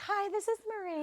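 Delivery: high, sing-song phone greeting; chirpy receptionist answering the phone; customer service voice. Retold or imitated. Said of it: "That's her customer service voice".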